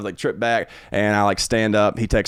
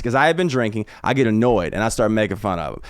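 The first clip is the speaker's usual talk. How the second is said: ordinary talk, nothing marked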